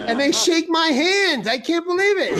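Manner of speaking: high-pitched, incredulous complaint; comic disbelief. Real, not acted. Mock outrage at being mistaken.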